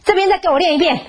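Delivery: angry mother. Retold or imitated. Mother ordering child to practise again, angry. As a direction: bark the order holding the stick. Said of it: "但是我就发怒了，".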